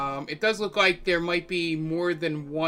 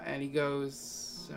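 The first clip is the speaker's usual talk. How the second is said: normal talking voice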